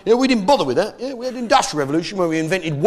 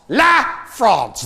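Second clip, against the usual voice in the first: "La" pitched high and set apart; stress on LA; 5 semitones higher than usual. Shown as a demonstration. Mocking what France calls itself, "La" high and emphatic. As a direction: punch "La" high, pause, then "France".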